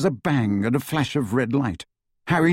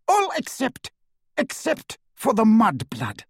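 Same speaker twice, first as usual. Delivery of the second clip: high, spiteful, pointed; single her out with spite; spiteful witch; 10 semitones higher than usual. A narrator's reading. Bellatrix singles out prisoner.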